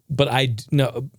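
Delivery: ordinary delivery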